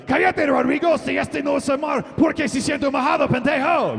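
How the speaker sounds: very high, loud shriek in Spanish; grito agudo de pez enfadado; Spanish fish yelling. Pretend. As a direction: scream it like an angry tropical fish. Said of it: "what they really said was".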